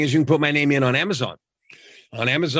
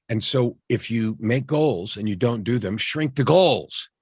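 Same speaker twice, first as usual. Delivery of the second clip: normal talking voice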